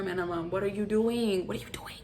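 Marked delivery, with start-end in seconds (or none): 1.5-1.9 whispered. Shown as a demonstration. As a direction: repeat it softer, in a whisper. Repeated, now whispered.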